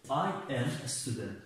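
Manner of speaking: very slow, word by word; teacher reading the example slowly; slow model sentence. Shown as a demonstration. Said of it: "همین am ترجمهش".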